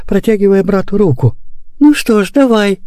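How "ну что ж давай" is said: put-on agreeing boy. A narrator's reading. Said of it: "согласился Лёня".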